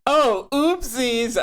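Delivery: high, slow mock innocence; fake innocent oopsies. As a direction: play dumb, very high and slow. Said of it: "I'm just gonna be like".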